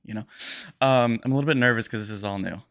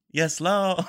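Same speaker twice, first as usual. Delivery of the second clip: higher, laughing "Yes, Lord" without the d; rapper catchphrase; 8 semitones higher, 4 dB louder than usual. Retold or imitated. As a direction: laugh out the clipped ad-lib. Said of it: "Two letters missing".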